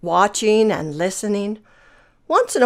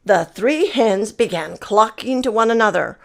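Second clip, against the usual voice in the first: plain reading voice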